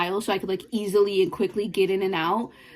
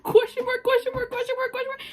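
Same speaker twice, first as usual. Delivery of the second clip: high, rapid-fire repeated squeak; high-pitched texting astonishment; incredulous squeak; 14 semitones higher, faster than usual. Retold or imitated. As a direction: squeak the punctuation out in mock disbelief. Acting out her incredulous text.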